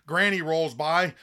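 normal talking voice

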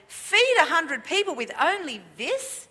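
high-pitched incredulous challenge; insolent questioning. Retold or imitated. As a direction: question the order as if it were absurd. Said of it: "and certainly questioning".

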